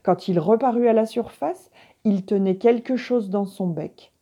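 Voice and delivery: usual speaking voice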